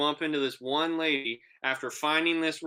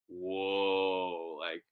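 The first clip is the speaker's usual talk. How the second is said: low, soft, drawn-out amazement; awed tripping reaction; 7 semitones lower, 5 dB quieter than usual. Retold or imitated. Telling how colors got super bright, low awed reaction. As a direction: act dazed wonder, low and quiet.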